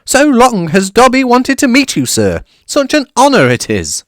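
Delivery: high, eager squeaky elf voice; a small excitable house elf, very high and loud; high-pitched grovelling elf; Dobby the house elf. A narrator's reading.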